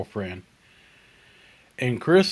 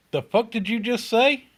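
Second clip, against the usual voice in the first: retorting much higher, indignant; a put-out tough guy, pitched way up; offended; 10 semitones higher than usual. Retold or imitated. Chris turning on the waitress, indignant and high.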